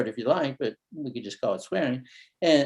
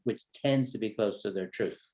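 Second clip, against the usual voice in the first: ordinary talk, nothing marked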